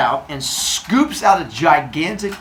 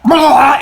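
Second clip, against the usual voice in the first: loud, high retching noise; mock vomiting; 11 semitones higher, 11 dB louder than usual. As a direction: fake a big throw-up. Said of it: "and he throws up everywhere".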